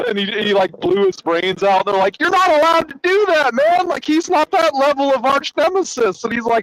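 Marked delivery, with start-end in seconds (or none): 2.2-6.2 high, insistent objection; alarmed protest. Retold or imitated. Villains telling the Monarch he broke the rules, alarmed protest. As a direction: imitate scandalised colleagues objecting, pitched way up.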